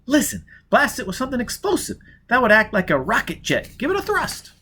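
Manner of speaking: put-on a young woman pitching a daring plan; Jiz, urgent plan. Jiz urging Foyle to blast the safe, eager woman's voice. Female character voice in narration.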